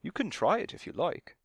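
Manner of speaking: a hint of a British accent; slight British accent. A narrator's reading. Stunt cat offers Sam a try, slight British accent. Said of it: "He had a slight British accent".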